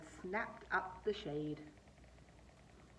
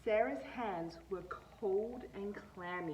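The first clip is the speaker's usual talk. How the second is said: usual speaking voice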